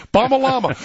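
laughing through the words, voice jumping high. Real, not acted. Host cracking up at the absurd terror target list, laughing. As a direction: crack up while repeating the absurd phrase.

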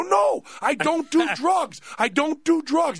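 high, drawn-out retold protest; indignant protest. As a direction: plead innocence at the top of your range. Protesting a false accusation.

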